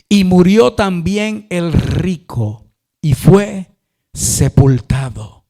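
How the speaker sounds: slow, loud, solemn proclamation; drawn-out, declamatory Bible reading; scripture reading. Acted. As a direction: proclaim each phrase weightily, pausing between. Reads the Bible verse aloud.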